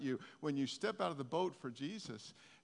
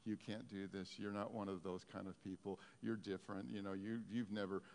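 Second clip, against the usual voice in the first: discouraging inner voices; 6 semitones lower, 8 dB quieter than usual. Acted. Preacher voicing doubts crowding in on a believer, quiet and low. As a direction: lightly voice the doubts in an undertone. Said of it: "the other voices crowd in".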